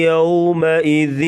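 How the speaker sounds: یومئذ drawn out slowly with a soft gliding یو; soft leen vowel. Shown as a demonstration. Teacher demonstrating a soft leen vowel in یومئذ. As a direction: recite یومئذ slowly, easing gently through the و. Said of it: "یوم کی واو جو ہے لین ہے".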